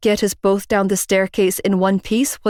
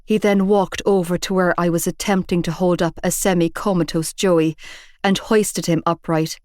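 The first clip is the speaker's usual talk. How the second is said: plain reading voice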